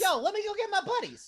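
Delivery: high laughing rat dude; chirpy rat, laughing through it. Acted. GM voices a rat.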